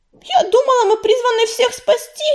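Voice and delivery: high, plaintive cry of disappointment; sorrowful protest. A narrator's reading. Белочка protests their failed calling to save everyone, sorrowful. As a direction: pitch it right up, hurt. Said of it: "горестно мяукнула Белочка".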